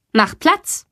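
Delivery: a touch of strict teacher commanding a dog; stern command. A narrator's reading. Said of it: "Sie blickt streng zu Sally und ruft".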